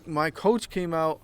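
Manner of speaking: ordinary talk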